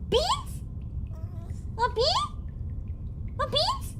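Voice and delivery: very high rising questions; high pet-talk. Retold or imitated. Quoting how she talks to her dog, high baby talk. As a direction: offer the treat sing-song. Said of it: "she's like".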